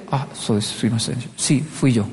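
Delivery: low, soft admission; meek confession. Retold or imitated. Japanese person asked about a misdeed, meek confession. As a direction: own up quietly and humbly. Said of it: "lo interesante con los japoneses".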